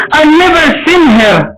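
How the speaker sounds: high, crying plea; tearful plea. Acted. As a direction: beg through tears. Bartimaeus pleads, voice crying.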